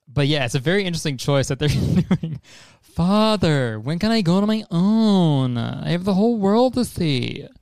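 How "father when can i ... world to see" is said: higher, drawn-out mocking puppet voice; exaggerated theatrical cartoon-boy impression; Pinocchio. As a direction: mimic the trailer's boy, slow and singsong. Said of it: "you know what it actually sounds like".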